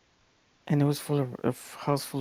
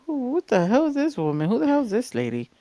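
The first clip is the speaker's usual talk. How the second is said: high-pitched mock disbelief; bewildered retort; 8 semitones higher than usual. Baffled younger self reacting to sister's older girlfriend, bewildered. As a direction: act out being baffled by a stranger.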